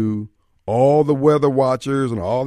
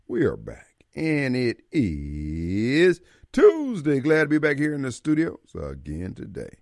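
radio show opening. Acted. Host welcomes listeners back to the open forum, radio show opening. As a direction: stretch the key words like a showman opening up.

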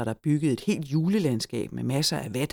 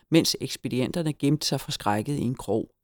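usual speaking voice

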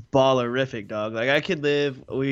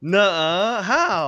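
high, loud gasp of disbelief; shocked disbelief; 8 semitones higher, 5 dB louder, much slower than usual. Real, not acted. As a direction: react like you can't believe it. Shocked reaction.

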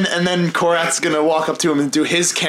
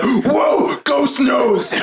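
high amazed yell; surprised shout; 11 semitones higher, much slower than usual. Retold or imitated. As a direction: gasp it out in amazement. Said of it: "and then he's like".

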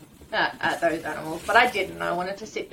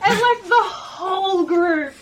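high, slow, helpless laughing delivery; 10 semitones higher, 5 dB louder, slower than usual. Real, not acted. Hunter telling how whole group heard her, laughing. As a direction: talk through a real laugh.

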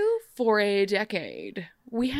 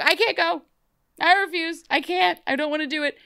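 whiny refusal; 8 semitones higher, 4 dB louder than usual. Retold or imitated. Emma refusing to go to ball.

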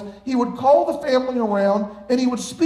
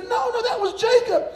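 lightly voiced Isaac; 12 semitones higher than usual. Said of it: "He said".

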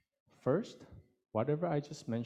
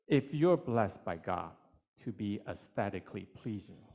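ordinary delivery

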